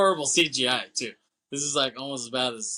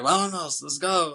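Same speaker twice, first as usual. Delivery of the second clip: louder, higher mock order to leave; acted hurry-up call, pitched up; urgent command; 6 semitones higher, 4 dB louder than usual. Fleeing boss to pilot, mock urgent command.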